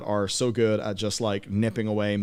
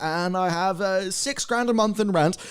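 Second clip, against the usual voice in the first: mock American accent; 10 semitones higher than usual. Mocking a New York earner on millennial money, high American accent. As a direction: ham up a smug American boast, pitched high. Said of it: "I don't know what accent this is".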